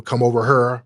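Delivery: "here" turned into "her", Midwestern-Southern; St. Louis twang with rounded r vowel; St. Louis accent. Shown as a demonstration. Host showing off the regional accents he knows, St. Louis accent. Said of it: "those accents are very distinct".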